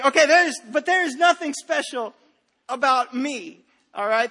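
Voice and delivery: loud insistent preaching; emphatic preaching. Real, not acted. Pastor insisting to congregation, loud and emphatic.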